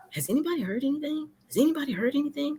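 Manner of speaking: hushed, high and rapid; anxious asking around. Retold or imitated. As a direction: fire off the question in a small, hurried voice. Acting out everyone anxiously asking around.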